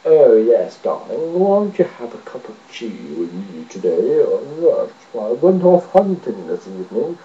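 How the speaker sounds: hammed-up upper-class English, swooping low; posh English. Mocking Englishmen in films, exaggerated posh English accent.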